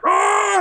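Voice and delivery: high joyful shriek of relief; hammed-up happy scream. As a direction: let out a delighted yelp. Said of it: "So I was like".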